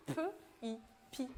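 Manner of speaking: "P" as a bare puff, then "I", then "pi"; sounding out. Shown as a demonstration. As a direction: separate "P" and "I", then blend them into "pi". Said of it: "quel bruit ça fait".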